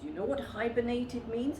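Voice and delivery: plain reading voice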